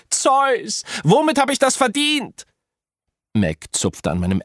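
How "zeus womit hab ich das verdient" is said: wailing to the sky. A narrator's reading. Apollo howling at Zeus, hammed-up despair. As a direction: howl up at the heavens. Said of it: "heulte zum grausamen Himmel hoch".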